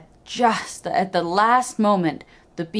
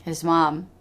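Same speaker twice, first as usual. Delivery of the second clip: plain reading voice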